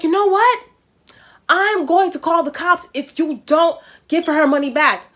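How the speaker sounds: retold indignant ultimatum, pushed up high; really mad. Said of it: "he was really mad".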